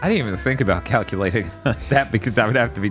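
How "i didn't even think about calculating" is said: normal talking voice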